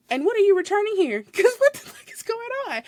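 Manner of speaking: high, loud, incredulous question breaking into a laugh; can't believe what she's seeing; baffled disbelief. Retold or imitated. Cashier baffled as customer returns an empty pack.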